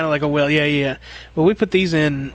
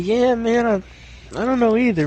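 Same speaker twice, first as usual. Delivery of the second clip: higher, easygoing customer voice; voicing the customer; 6 semitones higher than usual. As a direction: lightly play the laid-back homeowner.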